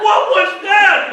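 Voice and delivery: high, loud, drawn-out disbelief; hammed-up outraged yell; mock outrage. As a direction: bellow it in mock indignation. Acted outraged reaction to football.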